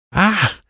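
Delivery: sudden high yelp. A narrator's reading. Alan yelps as the cards move, startled yelp. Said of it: "Alan yelped".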